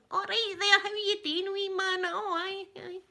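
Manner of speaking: high, put-on wee Ned voice; young Ned lingo. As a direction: mimic young locals greeting each other. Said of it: "I know their lingo".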